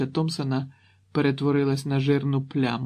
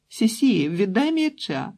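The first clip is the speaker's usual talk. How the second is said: put-on high, drawn-out child's yell; little girl shouting; 9 semitones higher, 5 dB louder, slower than usual. A narrator's reading. Younger girl demands the ball from her sister, shouting. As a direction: cry out like a demanding small sister. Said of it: "закричала менша дівчинка".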